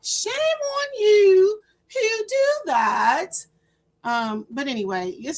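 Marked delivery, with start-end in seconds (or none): 0.0-3.3 put-on high, slow, wailing Virginia accent. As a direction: cry out the shame in a Virginia voice. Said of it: "Let me say it in my Virginia voice".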